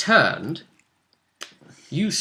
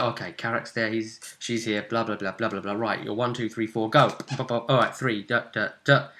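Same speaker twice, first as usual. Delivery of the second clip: retold self-talk; 4 semitones lower, 5 dB quieter than usual. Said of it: "So I'll be like".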